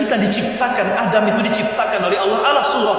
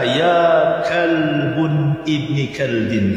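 very slow, drawn-out gentle address; 5 semitones lower, much slower than usual. Retold or imitated. Father teaching his son to address a dog, gently. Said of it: "ucapkan dengan kalimat yang lembut".

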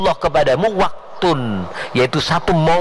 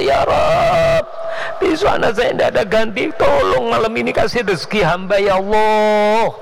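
desperate crying prayer; 7 semitones higher than usual. Retold or imitated. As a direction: beg with everything, voice breaking upward. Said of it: "Ini nangis sama Allah".

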